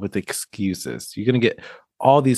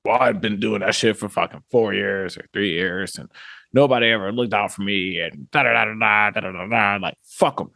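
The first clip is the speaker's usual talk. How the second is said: bitter grumbling. Retold or imitated. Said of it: "You gotta sit back and be like".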